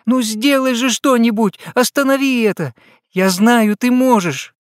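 very high, tearful, pleading; desperate crying plea. A narrator's reading. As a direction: beg him through tears. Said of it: "закричала Дарёна".

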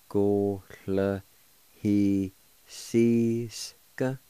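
"Go le he sees ga" drawn out very slowly, one syllable at a time. Shown as a demonstration. Teacher to radio learners, demonstrating a Cherokee word, very slow. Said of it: "and it's pronounced like this".

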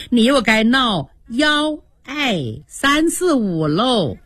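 幺爱三四五 slow, 爱 for 二, teasing; mocking the lisp. Daiyu teases Xiangyun about lisping counting, slow mock lisp. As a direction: count 幺爱三四五 like a lisper, slowly. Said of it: "偏是咬舌子".

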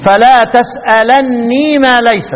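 تسألني with a doubled nun and the ya sounded, very slow; Warsh reading. Shown as a demonstration. Teacher demonstrating Warsh's reading to students, slow recitation. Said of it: "يبقى يقرأ ورش إيه؟".

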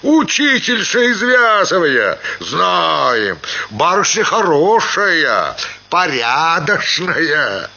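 high-pitched tavern calls, drawn out at the end; voices from next table. A narrator's reading. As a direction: call out tipsy approval across tables. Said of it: "доносилось с соседнего стола". (возгласы подвыпивших мужиков)

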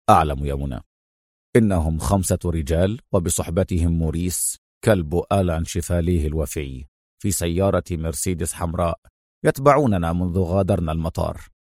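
low, soft, unruffled hero; calm. A narrator's reading. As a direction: reassure her in a deep, quiet voice. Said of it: "أجابها في هدوء". (هدوء وثقة بصوت منخفض)

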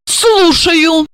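a curt, high dry word; dry reply. A narrator's reading. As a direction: answer drily, giving nothing. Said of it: "сухо ответила она". (сухо)

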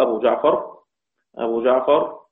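ordinary delivery

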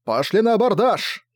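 high rallying call; battle cry. Acted. Filit leads sailors onto the enemy ship, battle cry. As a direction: send the crew over with a lifted cry. Captain leads boarding charge.

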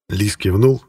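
usual speaking voice

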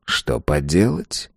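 low, slow, quiet sigh of a reply; resigned. A narrator's reading. Resigned answer from platform five. (смиренно, тихо и медленно)

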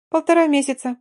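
high, light, dismissive answer; breezy. A narrator's reading. Olya waves away Katya's question, breezy and high. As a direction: toss it off airily. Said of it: "махнула рукой та".

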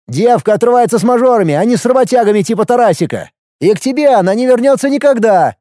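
high, heated harangue; agitated, scornful telling-off; exasperated tirade. A narrator's reading. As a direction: hammer the truth home, pitch climbing. Brother harangues him about his ex.

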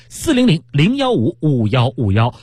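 slow, deliberate hotline number; slow phone number read. Acted. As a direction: spell out each digit slowly. Reads order hotline number.

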